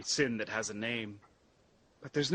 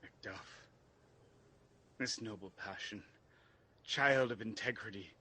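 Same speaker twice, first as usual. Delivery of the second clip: softer, lower, tender admission; hushed sincerity; 5 semitones lower, 7 dB quieter than usual. Acted. Malcolm confiding to Macduff after testing him, softly. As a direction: gently drop the pretence.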